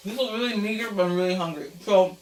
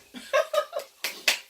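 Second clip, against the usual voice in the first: high giggle. Real, not acted. Laughing after the cheer.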